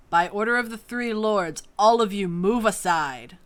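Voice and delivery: loud guard's order; commanding shout. Acted. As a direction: bellow it with authority. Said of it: "a much more commanding voice call through the crowd".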